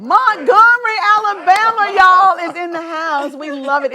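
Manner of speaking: loud, high hype-man announcement; hype shout. Real, not acted. Host shouting out the guests' hometown to the audience, high and loud.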